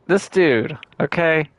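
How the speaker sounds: ordinary talk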